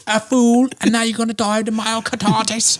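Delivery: put-on high British accent rant; British accent, pitched well up; Russell Brand impression. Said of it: "Russell Brand going on talk shows".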